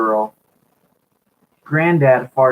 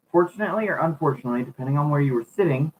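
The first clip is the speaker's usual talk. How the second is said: rushing the read; 4 dB quieter, much faster than usual. Real, not acted. Rushing through take four.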